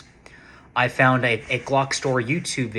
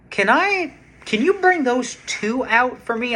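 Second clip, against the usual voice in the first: eager high request; 13 semitones higher, 4 dB louder, slower than usual. Retold or imitated. Asking the clerk to bring both pistols, eager and high. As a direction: voice leaps up, slow and eager.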